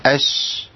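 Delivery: أش with a long hissed sh that fades by itself; أش drawn out, whispered sh; sustained sh demo. Shown as a demonstration. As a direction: hold the sh of أش until it dies out. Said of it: "نمشي الصوت ونقطع الصوت".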